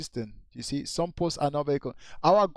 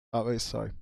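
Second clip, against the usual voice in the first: normal talking voice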